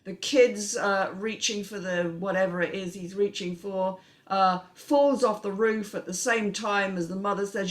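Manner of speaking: ordinary delivery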